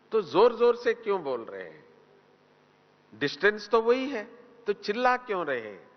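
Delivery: high-pitched, rapid challenge, imitating a puzzled questioner; acted questioning remonstration. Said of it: "किसी ने पूछा था".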